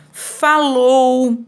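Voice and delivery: FALOU stretched out slowly, held level; drawn-out example word. Shown as a demonstration. Teacher giving pupils an example of a verb, drawn-out emphasis. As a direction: say FALOU long and deliberate.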